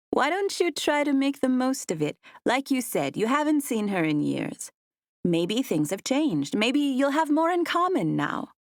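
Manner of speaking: lightly voiced mother, gently persuading; a hint of a hopeful mom; mom coaxing. A narrator's reading.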